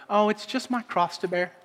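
mock the easy version of it; a hint of flippant self-pity; mock casual sigh. Mocks a casual sentiment.

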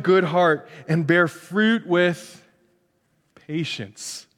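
measured, reverent reading; scripture reading. Real, not acted. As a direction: read the verse with care.

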